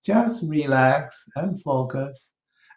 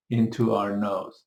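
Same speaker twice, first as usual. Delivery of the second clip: ordinary talk, nothing marked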